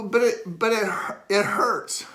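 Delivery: whiny pain complaint. Retold or imitated. Acting out complaint to therapist.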